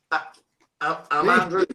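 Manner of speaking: long pause, then a halting stammer; hesitant owning-up. Retold or imitated. Extra answering the assistant director's search, hesitant.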